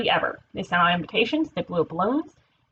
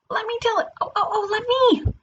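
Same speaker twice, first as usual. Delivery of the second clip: high-pitched eager interrupting voice; eager little character; 12 semitones higher, faster than usual. Acted. Character begging the narrator to tell it, eager high voice.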